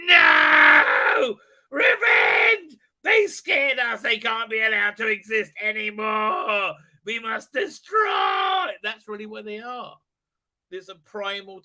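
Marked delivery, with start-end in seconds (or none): 0.1-8.7 higher, louder furious shouting; hammed-up enraged shouts, climbing high at the end; freaking-out base. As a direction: rant like a crowd demanding revenge. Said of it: "They would freak out".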